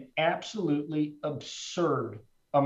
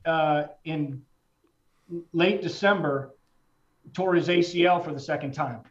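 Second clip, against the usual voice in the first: voice breaking; 2 semitones higher than usual. Real, not acted. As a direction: push the words out through real grief. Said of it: "see, this is hard".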